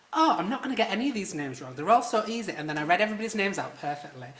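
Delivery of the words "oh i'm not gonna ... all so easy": mock overconfident. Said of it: "I was like".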